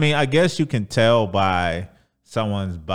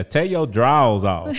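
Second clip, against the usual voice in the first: put-on country accent; 3 semitones higher, 7 dB louder than usual. Showing how country it sounds, loud country accent. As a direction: say "drawers" country-style, as "drawls", punched loud. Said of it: "Draws would be more country".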